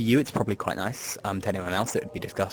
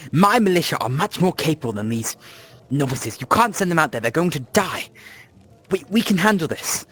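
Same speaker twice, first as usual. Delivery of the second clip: raised, high-pitched protest from behind a door; loud voice; 8 semitones higher, 7 dB louder than usual. Acted. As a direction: argue loudly and urgently. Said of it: "a loud voice coming from inside".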